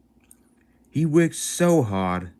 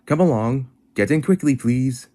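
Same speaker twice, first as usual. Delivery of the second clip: higher, louder hurry-up call; urging call; 6 semitones higher, 5 dB louder than usual. Acted. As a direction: hurry the passengers aboard. Engine urging passengers.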